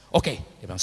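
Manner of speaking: ordinary talk, nothing marked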